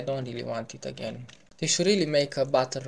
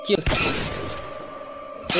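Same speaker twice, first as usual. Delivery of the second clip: mock whisper; 5 semitones higher, 4 dB louder, much slower than usual. Sneaky plan against NPC.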